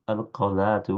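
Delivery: normal talking voice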